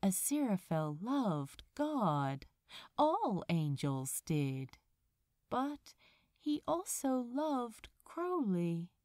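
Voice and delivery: usual speaking voice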